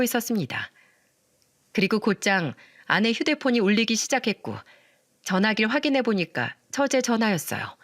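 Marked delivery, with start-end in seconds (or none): none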